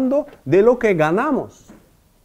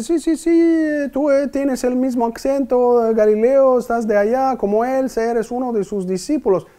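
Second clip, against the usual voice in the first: higher-pitched, lightly voiced insistent woman; accusing woman; 6 semitones higher than usual. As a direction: play the servant woman pressing Peter, pitched up. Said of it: "Y la mujer le dice". (mujer que acusa a Pedro, con voz más aguda e insistente)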